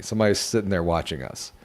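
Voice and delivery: ordinary delivery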